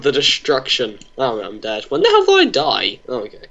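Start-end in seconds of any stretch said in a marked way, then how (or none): 1.8-3.0 high, startled outburst of disbelief; sudden pitch jump, genuinely baffled; shocked disbelief. Real, not acted. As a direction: yelp it, caught off guard by dying. Shocked to find himself dead.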